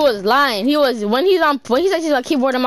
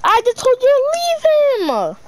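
exasperated yelling; 13 semitones higher than usual. Real, not acted. Angry at teammate.

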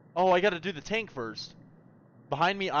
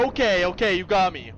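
ordinary delivery

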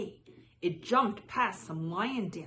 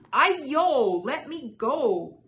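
high, loud, drawn-out protest; crying out; 6 semitones higher, 10 dB louder, slower than usual. A narrator's reading. Caught rice cake protests to the boy, crying out. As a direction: wail it loudly as a captive would. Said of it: "The Nian Gao cried".

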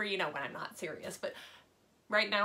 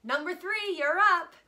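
cheerful call; 6 semitones higher, 7 dB louder than usual. Acted. Kelly calling classmate.

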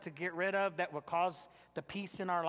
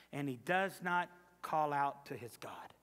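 normal talking voice

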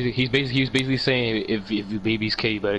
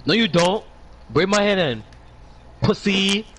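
raised, higher teasing jab at a friend; mock taunt; 6 semitones higher than usual. Teasing Sienna because she can't braid hair.

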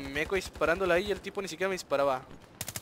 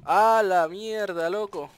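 slow, loud frustrated yell; drawn-out shout; 3 semitones higher, 7 dB louder, much slower than usual. Real, not acted. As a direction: stretch it out in anger. Frustrated outburst after dying.

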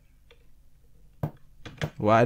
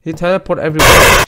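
he said loud and sharp; loud wrong take; 6 semitones higher, 12 dB louder than usual. Real, not acted. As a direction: blurt out he teleport, loud. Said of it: "Why do I keep pronouncing he as a she?".

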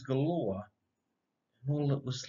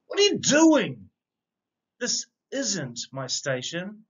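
the driver bursts out in indignation; indignant shout; 6 semitones higher, 3 dB louder than usual. A narrator's reading. Train driver shouting at the dog, indignant and high. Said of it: "shouted the driver with great indignation".

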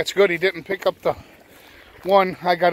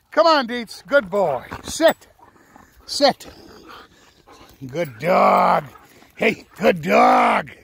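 excited praise; 6 semitones higher, 5 dB louder, slower than usual. Real, not acted. Praising and commanding the dog.

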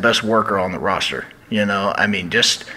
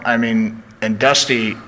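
ordinary talk

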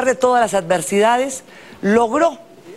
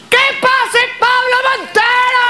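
announcer shout. Real, not acted. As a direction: call the star onto the stage. Host announcing star guest.

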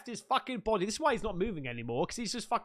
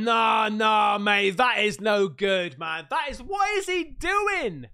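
loud, real disbelief at what he sees; shocked shout; 7 dB louder than usual. Real, not acted. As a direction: shout it out, truly appalled. Real shocked reaction to video.

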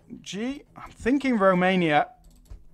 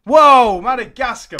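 amazed shout; 7 semitones higher, 9 dB louder than usual. Real, not acted. Amazed at the game result.

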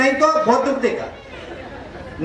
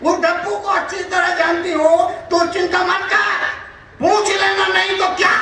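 high, exclaimed retold assurance; emphatic urging; 8 semitones higher than usual. Old woman telling the wife not to worry, emphatic. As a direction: urge her on in a high voice.

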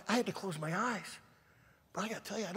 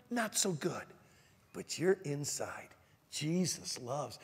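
usual speaking voice

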